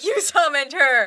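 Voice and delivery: slow, drawn-out accusation, half laughing; hammed-up slow accusation; a mock-dramatic accuser, laughing through it; mock accusing. Boyfriend accusing ex, retold.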